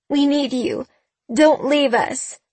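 high, slow tearful plea; begging. A narrator's reading. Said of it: "she begged".

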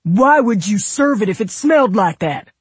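loud, high, furious demand; angry shouted interrogation; screaming. A narrator's reading. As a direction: yell the question in outrage. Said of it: "Royal was screaming about mayonnaise".

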